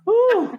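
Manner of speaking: sudden high whoop; high woo. Real, not acted. Friend whooping after a joke, high and quick. Exclamation cry.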